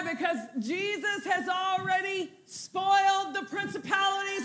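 loud, high, fired-up preaching; rally the congregation to the top of the stairs; preacher urging the church to lift their hands, loud and high; preaching at full force. Acted.

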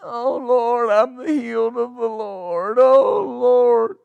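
sobbing mock lament, pitched high; mock crying. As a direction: ham up a tearful, pitiful plea. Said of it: "Are you quoting it like that?".

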